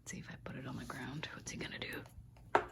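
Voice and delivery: hushed fast whisper to the camera; quick secret whisper. Real, not acted. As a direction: whisper the plan conspiratorially. Whispered aside to viewers.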